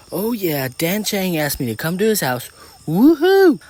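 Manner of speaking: high, loud celebration rising to a whoop; ecstatic inner yell. A narrator's reading. Voice in girl's head after party invite, yelling. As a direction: cheer wildly at the good news. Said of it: "the voice in my head yells".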